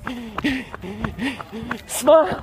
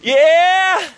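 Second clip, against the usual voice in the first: excited cheer; 5 semitones higher, 4 dB louder than usual. Real, not acted. Crossing the finish line.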